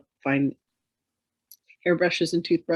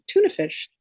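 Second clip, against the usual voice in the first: a much higher, playful chirp; playful squeak. Retold or imitated. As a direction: pipe it up like a silly child's answer. Retold silly craving answer.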